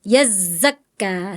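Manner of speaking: YAZAKKA with a long buzzing double z; thick zai. Shown as a demonstration. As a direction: hold the hissing z of YAZAKKA, slowly. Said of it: "kurang tebal".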